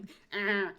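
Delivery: loud imitated grudging groan; reluctant imp groan. Host mimicking an imp who didn't want to attack, reluctant groan. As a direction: groan like an unwilling little monster. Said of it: "like he didn't want to".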